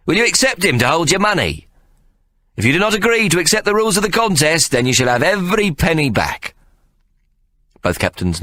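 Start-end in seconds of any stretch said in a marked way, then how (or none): 0.1-6.5 high, quick persuasive pitch; Ikey, showman. Acted. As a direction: sell the deal to them. Ikey addresses the captains.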